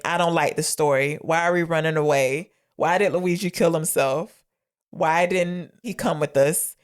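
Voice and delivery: ordinary delivery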